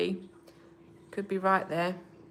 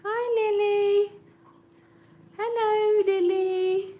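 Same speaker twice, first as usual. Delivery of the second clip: high, sing-song voice for the cat; pet talk; 12 semitones higher, 4 dB louder, slower than usual. Real, not acted. Greeting her cat Lily in a high cooing pet voice. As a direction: coo up high at the kitten.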